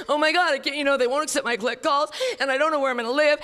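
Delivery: mock-frantic caller pouring out worries; panicky newcomer. Panicked newcomer calling sponsor.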